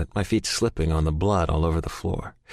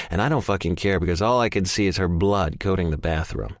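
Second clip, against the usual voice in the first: ordinary delivery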